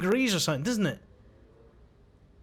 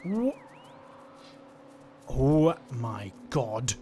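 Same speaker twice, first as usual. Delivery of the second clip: low, slow, stunned exclamation; hushed awe; 4 semitones lower, much slower than usual. Real, not acted. Player takes in the baby creature, low stunned awe.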